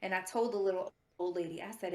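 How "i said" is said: ordinary talk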